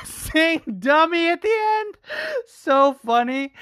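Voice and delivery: words breaking into laughter; cracking up mid-sentence; laughing. Real, not acted. Host laughing at Chael's insult, real laughter.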